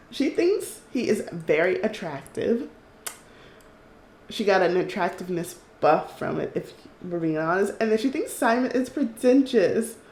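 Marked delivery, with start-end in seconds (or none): none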